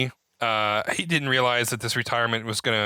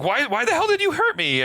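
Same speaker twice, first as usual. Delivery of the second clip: pitched way up, indignant; hammed-up cry of betrayal; mock outraged yell; 13 semitones higher than usual. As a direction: shout it up high like he's wounded. Retold fight in water.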